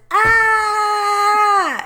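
long, high, loud scream; imitated child scream. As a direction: hold one sustained high "ah" scream. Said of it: "she was just like this".

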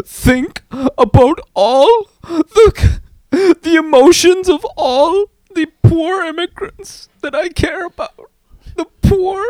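high, slow, hammed-up weepy voice; mock tearful plea. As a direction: fake the tears about the poor immigrants. Mocking politician's fake sympathy.

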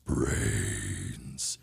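slow low moaning groan; zombie groan. Acted.

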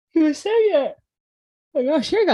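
yawning mid-sentence. Real, not acted. Tired teacher apologizing to her cohost, yawning out the words. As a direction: yawn through it, can't stop it. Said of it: "Excuse me, I keep yawning".

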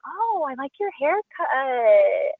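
high, drawn-out fake compliment; mock polite compliment. Onlookers to her about a jacked up haircut, mock polite. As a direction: stretch it out high, awkwardly insincere. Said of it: "people are like, they look at you like".

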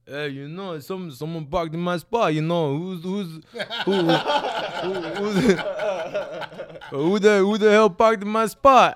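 loud, laughing mock Stallone yell; Stallone calling out. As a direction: bellow it while cracking up. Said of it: "I hear".

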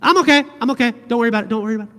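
high, loud, brushing-it-off "I'm okay"; mock brave denial. Pastor imitating someone hiding hurt, forced brightness. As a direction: wave off concern, too brightly. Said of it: "With that tone and inflection?".

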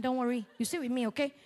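usual speaking voice

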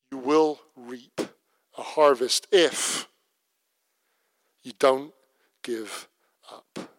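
slow, soft, deliberate exhortation; solemn scripture reading. Acted. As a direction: let each phrase land slowly and gently. Scripture read as closing exhortation.

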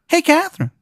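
very high-pitched cartoonish tooth; high put-on mascot voice; tooth character voice. Tooth character calls out to player.

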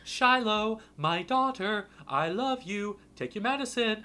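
high, loud sung-out father voice; loving father. Retold or imitated. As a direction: croon it grandly to a beloved daughter. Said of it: "Because before he's like".

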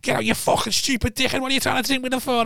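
high-pitched imitation of an angry sister scolding; mock furious sister. Retelling the sister yelling at her boyfriend, high mock fury. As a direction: do the fuming sister, squeaky and high. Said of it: "She was like".

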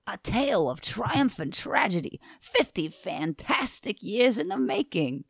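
grandiose New York showman, drawn out; Stan Lee voice. Acted. Co-host reads red word bubble on request, Stan Lee voice. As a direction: hype the tagline slowly in a New York accent. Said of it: "do the Stan Lee voice again".

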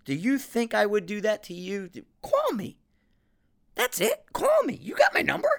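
indignant challenge climbing to a very high pitch; exasperated rant, voice shooting up high; East Coast outburst. Retold or imitated. Telling off someone who got offended, high-pitched indignant outburst. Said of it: "it's East Coast Frank came out today".

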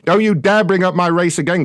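ordinary talk, nothing marked

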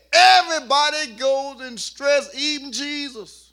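loud, high, ringing sermon climax; full-force preaching. Real, not acted. Pastor preaching to his congregation, at full force. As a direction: preach it out to the whole church.

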